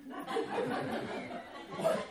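squeaky airless giggle; high breathless laugh. Real, not acted. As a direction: let a high silent laugh escape.

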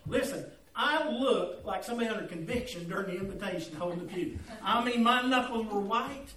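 ordinary talk

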